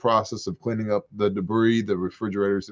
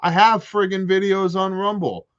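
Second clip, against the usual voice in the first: high, insistent exasperation; exasperated; 7 semitones higher than usual. Real, not acted. Answering everybody who says he's missing from Rumble, exasperated. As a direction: protest it high, hitting the opening hard. Exasperated rebuttal to nagging viewers.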